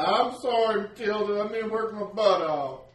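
high, apologetic villager; sheepish farmer. Acted. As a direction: apologize to Matilda. Clem apologizes to Matilda.

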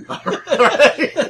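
loud, high laugh through the words. Real, not acted. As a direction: burst out laughing while answering. Player laughs at GM threat.

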